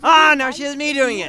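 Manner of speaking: high, loud exasperated cry; exasperated outburst. Acted. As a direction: throw up your hands in a loud huff. [name] catching dramatic habit.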